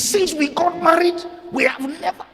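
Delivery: high, drawn-out mock boast; mocking imitation. Preacher quotes a boasting minister to the church, mockingly.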